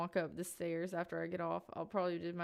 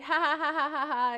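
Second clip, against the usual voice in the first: spoken fake laugh; 7 semitones higher, 8 dB louder than usual. Mock cheerful laugh.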